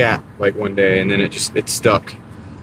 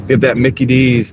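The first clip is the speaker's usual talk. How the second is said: normal talking voice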